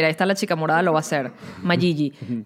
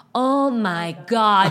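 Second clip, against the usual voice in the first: mock gringo awe; much slower than usual. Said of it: "los gringos alrededor era".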